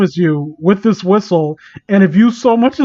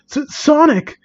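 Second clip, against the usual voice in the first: startled cry; 9 semitones higher than usual. Acted. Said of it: "You shout".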